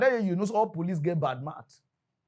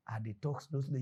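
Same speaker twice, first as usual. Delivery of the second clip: ordinary delivery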